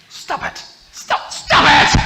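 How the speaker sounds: high loud mom yell, slow. Retold or imitated. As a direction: stop the fighting kids. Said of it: "She says".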